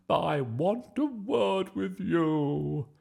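slow, high, furious bellow; booming. A narrator's reading. Miss Boot confronting Bertie at the door, booming. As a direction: boom each word out menacingly. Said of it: "boomed Miss Boot".

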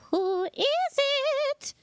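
high, slow singsong princess voice; Ophelia. Acted. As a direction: draw it out sweetly, way up high. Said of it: "I'll do Ophelia's voice".

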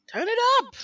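loud high call to turn it up; shouting. Real, not acted. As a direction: yell it over the music. Shouting for music up.

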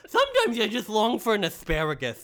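King Candy. Pretend. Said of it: "I sound like the Mad Hatter".

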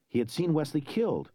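usual speaking voice

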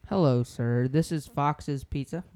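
loud, slow pizza-shop greeting; pizza shop caller. Acted. Prank call greeting.

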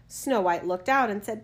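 ordinary delivery, nothing marked

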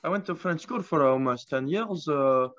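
hammed-up French accent. Claiming French schooling to friends, mock French accent. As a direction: boast in a put-on French accent. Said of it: "my French accent is actually pretty good".

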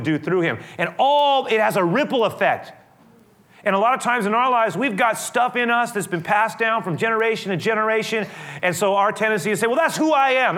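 high, loud full-force sermon voice; fervent preaching. Acted. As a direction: preach it out to the whole congregation.

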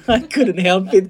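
laughing through the words; high, loud, giggling it out. Retold or imitated. Menceritakan haru dipeluk. (tertawa sambil bicara)

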